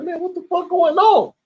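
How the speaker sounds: high, startled question; alarmed disbelief. Retold or imitated. Peering out at blood.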